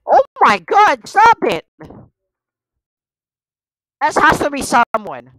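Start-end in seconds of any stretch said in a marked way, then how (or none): 0.1-1.7 high, loud drawn-out plea; scared screech; panicked yell. Real, not acted. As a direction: shout in real fright. Jumped again by game enemy.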